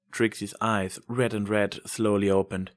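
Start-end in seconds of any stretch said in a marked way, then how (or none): none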